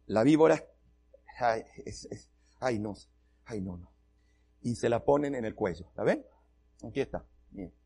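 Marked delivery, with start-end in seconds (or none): 1.4-3.9 much softer, lower nervous ay no; frightened whimper. Retold or imitated. Pastor sees the snake on his daughter, quiet fearful whimper. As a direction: shrink back muttering in fear.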